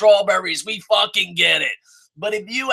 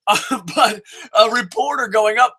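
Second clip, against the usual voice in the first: ordinary talk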